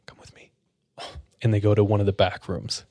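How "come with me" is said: hushed whisper, checking no one hears; secretive whisper. Retold or imitated. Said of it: "And she says, looking around".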